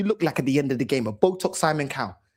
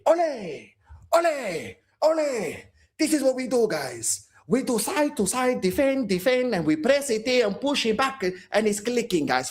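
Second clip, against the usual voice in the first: much higher hammed-up manager voice; high mock Arteta ole; 7 semitones higher than usual. Fan parodying Arteta's tactics talk, high and hammed-up. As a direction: chant ole, then preach side to side.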